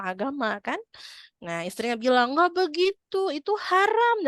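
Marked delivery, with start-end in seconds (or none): 2.3-4.3 high, preachy know-it-all correction; lecturing wife. Retold or imitated. Wife correcting husband who hasn't studied, preachy. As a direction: set him straight from on high.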